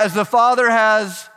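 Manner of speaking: loud slow shouted call for the crowd to join; full-voiced shout, high and loud. Real, not acted. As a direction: belt it so the whole room joins in. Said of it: "Help me out".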